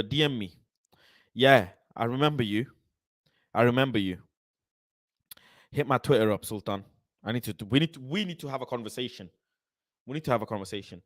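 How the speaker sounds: ordinary delivery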